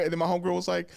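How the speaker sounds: ordinary delivery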